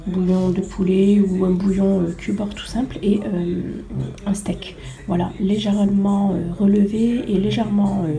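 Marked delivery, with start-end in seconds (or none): none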